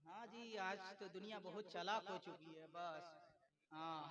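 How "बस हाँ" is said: usual speaking voice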